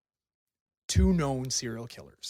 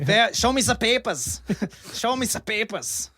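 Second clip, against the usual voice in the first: a hammed-up German accent barking orders, higher and much louder; mock German killer; 9 semitones higher, 8 dB louder than usual. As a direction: play a comic German officer demanding papers.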